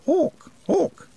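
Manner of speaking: high shrieking cry from far off; distant scream. A narrator's reading. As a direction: shriek it high, echoing down the pipes. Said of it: "there was a scream of".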